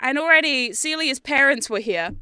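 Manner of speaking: ordinary delivery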